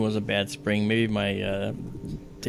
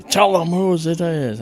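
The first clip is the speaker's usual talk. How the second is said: slow, loud, raised-pitch angry order; stern father's demand; 8 semitones higher, 6 dB louder, slower than usual. Retold or imitated. Father pulling his son's hair demands the owner, loud and angry. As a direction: bellow it slowly like a dad losing patience. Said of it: "my father grabs a little short hair".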